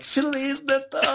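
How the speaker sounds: high, sing-out festive cheer, acted out; mock-jubilant holiday shout; gleeful group greeting. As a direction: call it out high, breaking into laughter. Group springing a Christmas prank, laughing.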